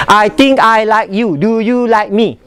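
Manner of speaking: word-by-word, unlinked delivery; choppy, no linking between words; staccato. Shown as a demonstration. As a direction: keep every word separate and even. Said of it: "Staccato is pop".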